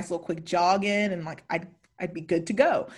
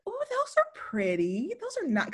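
imitated approving mom; 9 semitones higher, 7 dB quieter than usual. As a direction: coo over them like a mock mother. Mom cooing over new earrings.

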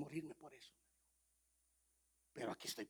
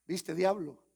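God taunting devil; 4 semitones higher, 13 dB louder, much slower than usual. Retold or imitated.

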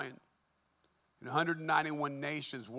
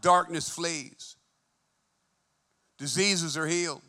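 preaching at full force; 5 dB louder than usual. Real, not acted. Preaching climax to congregation.

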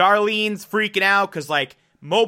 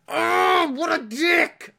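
high, disgusted outcry; a high cry of disgust at the villain; 12 semitones higher, slower than usual. Real, not acted.

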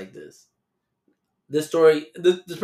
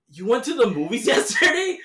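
high, loud, laughing through the question; can't believe it, laugh mid-line; laughing disbelief; 10 semitones higher, 4 dB louder than usual. Retold or imitated. Telling the girl about the dad's movie night, laughing in disbelief.

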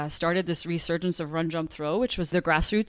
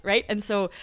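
normal talking voice

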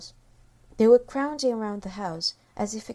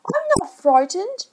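put-on high child's voice, defiant; child protesting; 11 semitones higher, 5 dB louder than usual. A narrator's reading. Michael answering his father, high boyish defiance. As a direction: pipe it up like a small boy.

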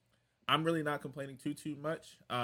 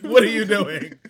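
a louder, higher mock scold of a coworker; 8 semitones higher, 17 dB louder than usual. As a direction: throw it at him like a fed-up coworker. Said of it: "He said".

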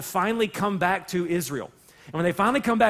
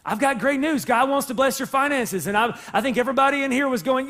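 normal talking voice

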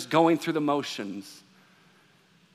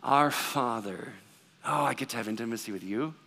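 slow down and mean each word; slow, meant-it prayer; 7 semitones lower, 7 dB quieter than usual. Retold or imitated. Believer pausing to truly address God, slow and reverent. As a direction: say "Our Father" slowly and reverently, as if for the first time. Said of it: "instead of stopping going".

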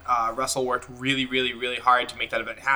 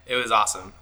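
ordinary talk, nothing marked

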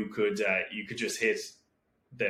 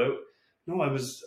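usual speaking voice

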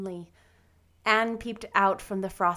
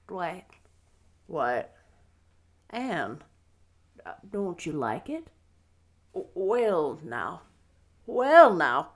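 low, halting, hesitant voice; shy, flustered man; 3 semitones lower, 4 dB louder, slower than usual. A narrator's reading. Matthew asks Anne whether she likes it, shy and flustered. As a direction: stammer gently, with long pauses. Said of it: "said Matthew shyly".

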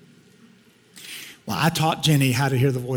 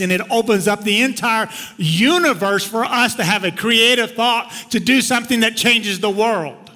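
higher, louder, driving sermon delivery; impassioned preaching; 7 semitones higher, 4 dB louder than usual. Real, not acted. Preacher telling the room what magnifying God does, impassioned. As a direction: build it like a preacher at full force.

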